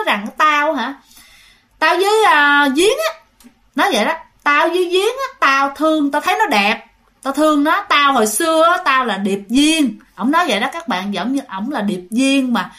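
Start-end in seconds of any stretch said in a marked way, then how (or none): 0.4-3.2 high, loud, gloating spirit; triumphant. Retold or imitated. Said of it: "đắc thắng á". 4.4-10.0 a hint of gloating spirit, higher; triumphant. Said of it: "đắc thắng á".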